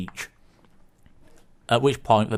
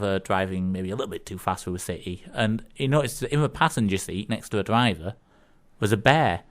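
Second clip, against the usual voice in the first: ordinary delivery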